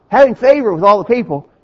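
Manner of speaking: "Having" punched loud and high, tune falling; stressed HAVING. Real, not acted. Preacher driving home the verse to his listeners, stressed. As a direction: lean hard on "Having favor with all the people". Preacher repeats key scripture phrase.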